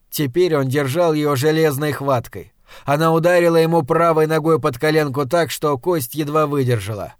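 usual speaking voice

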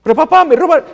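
loud, high outburst to dad; acted wail of complaint from a son; upset son protesting. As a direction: burst in over the father, agitated. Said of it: "como un padre le dice a un hijo".

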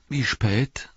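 high, sleepy hobbit question; yawning. A narrator's reading. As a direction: ask it through a light yawn. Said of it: "sagte Pippin gähnend".

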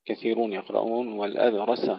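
normal talking voice

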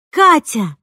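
loud call. A narrator's reading. Mother shouting her sister's name into the phone, loud call. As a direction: shout it down the phone as if to reach another town. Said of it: "закричала в трубку так громко".

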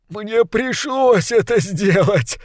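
high, slow, groaning confession; moaning. Acted. Second ratcatcher confessing under the spell, moaning high. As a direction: moan it out in misery. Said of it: "простонал крысолов номер два".

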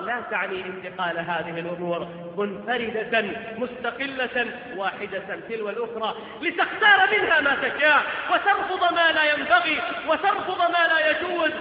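ordinary talk

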